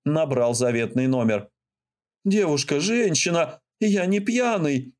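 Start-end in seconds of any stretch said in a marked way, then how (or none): 2.2-5.0 high, stumbling explanation; halting, flustered. Acted. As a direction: stammer it out, flustered. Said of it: "начал сбивчиво объяснять он".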